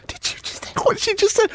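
shocked high gasp of disbelief; high astonished squeak. Retold or imitated. Stunned friends reacting again.

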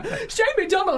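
high-pitched squeal of recognition; imitated excited shriek; mock excited fan. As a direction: call it out like a starstruck passer-by. Imitating fans recognising the star.

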